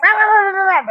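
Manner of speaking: high, loud nonsense ranting; mock complaining. Imitating how his mother mocks complaining people, high and loud. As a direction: squawk it like an imitated angry complainer. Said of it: "That's how she talks about people grabbing and complaining".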